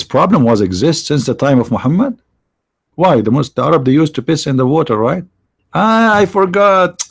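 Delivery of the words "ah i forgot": ordinary talk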